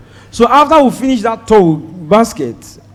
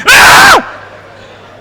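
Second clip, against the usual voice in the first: very high, sharp yelp of alarm; sudden high exclamation; shocked cry; 3 dB louder than usual. Retold or imitated. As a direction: let out a startled high cry. Outburst at crew's warning.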